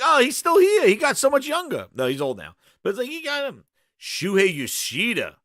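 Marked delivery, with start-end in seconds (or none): 0.0-1.8 high, loud, wide-eyed surprise; mock surprise. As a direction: gasp it out, amazed and fooled.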